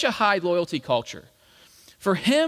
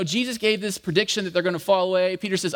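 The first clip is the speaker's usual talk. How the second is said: ordinary delivery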